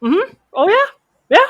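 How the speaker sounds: high, rising, mock-interested replies; barely holding it in. Fuming player on the chair answering an apology, barely contained. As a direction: feign interest while about to lose it. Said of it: "then there's me like this on the chair".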